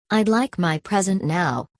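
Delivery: usual speaking voice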